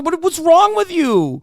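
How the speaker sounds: normal talking voice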